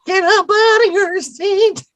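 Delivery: put-on high, slow, shaky old lady croon; grandma voice. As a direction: warble it like a frail grandma. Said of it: "Why is [name]'s voice so high and shaky?".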